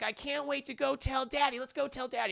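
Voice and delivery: high-pitched imitation of an eager young woman; mock-girlish excitement; excited fiancée. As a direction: put on a high, thrilled daughter voice. Andrea excited to share the news.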